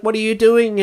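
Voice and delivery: lightly acted, high-pitched panicked girl; high voice, a touch of alarm; frightened girl. Retelling the girl tied in the basement, mildly frightened.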